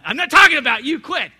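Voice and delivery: mock-defensive shout at a friend; playful loud protest. Real, not acted. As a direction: call out a laughing denial to the room. Teasing a congregant aloud.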